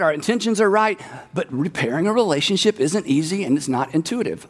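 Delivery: ordinary talk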